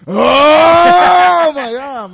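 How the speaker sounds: long, high, very loud anime scream; charging-up scream. Acted. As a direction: hold a power-up yell. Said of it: "three episodes of them charging up".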